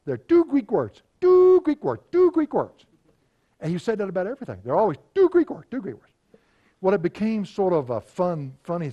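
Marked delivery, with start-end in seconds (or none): 0.0-2.8 high, loud imitation Greek accent; Spiros's Greek accent. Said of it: "would always say in his Greek dialect". 5.2-6.1 higher-pitched mock Greek accent; quick echo of a Greek mentor's catchphrase; Spiros's Greek accent. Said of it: "would always say in his Greek dialect".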